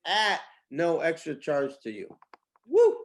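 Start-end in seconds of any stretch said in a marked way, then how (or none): 2.7-3.0 high, loud "woo"; celebratory whoop. Real, not acted. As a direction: let out a sudden high whoop. Celebratory whoop after offer.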